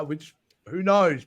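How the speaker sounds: ordinary delivery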